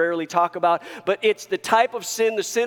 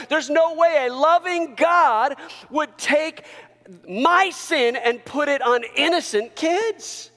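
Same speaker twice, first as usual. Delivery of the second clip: higher, slowed, disbelieving objection; incredulous protest; 8 semitones higher than usual. Acted. Preacher voicing a doubter questioning God, incredulous protest. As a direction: protest slowly in raised disbelief.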